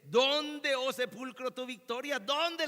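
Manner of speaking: loud, high-pitched taunting demand; sarcastic taunt. Retold or imitated. Preacher quotes the apostle mocking the grave, loud sarcasm. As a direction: throw the question in the grave's face, loudly. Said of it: "le pregunta de manera sarcástica".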